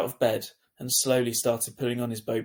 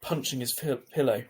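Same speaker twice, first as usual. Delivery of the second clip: usual speaking voice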